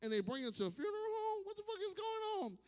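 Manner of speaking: rising to a high, strained squeak of confusion; bewildered disbelief. Retold or imitated. Confused man reacting to Bitchcoin, rising disbelief. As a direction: sound baffled by bitch money. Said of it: "I'm like".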